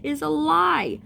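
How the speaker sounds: loud, high, drawn-out verdict; emphatic debunking. Acted. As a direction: call out the myth loudly. Mocks bad pregnancy advice.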